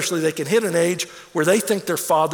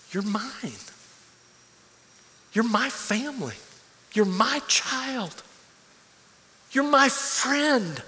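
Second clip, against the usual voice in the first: soft, slow and building; tender divine words; 5 semitones higher, 4 dB quieter, slower than usual. Acted. God telling his people they belong to him, soft and slow. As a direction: let each line swell gently.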